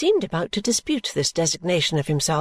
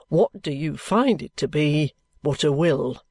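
slow, pointed question; 3 semitones lower, slower than usual. A narrator's reading. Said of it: "lively air of recalling a joke".

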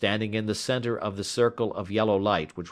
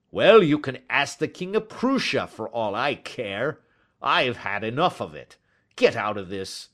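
angry dismissal; 5 semitones higher, 4 dB louder than usual. A narrator's reading. Salesman drives the man off.

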